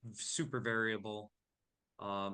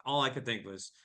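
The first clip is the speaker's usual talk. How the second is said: ordinary delivery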